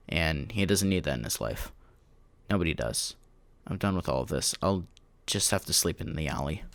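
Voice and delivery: low, quiet, defeated voice; resigned despair. A narrator's reading. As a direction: let it sink softly, as if giving up. Despairing narrator gives up.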